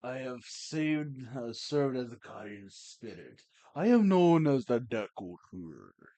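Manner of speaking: lightly voiced guardian tree spirit; Deku Tree voice. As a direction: voice a solemn tree spirit, measured. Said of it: "I'm not sure if I should do this voice".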